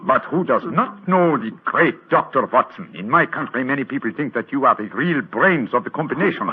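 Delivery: put-on a disguised detective's lightly held German accent; German count. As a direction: greet the visitors as a stiff German count. Holmes disguised as the Graf.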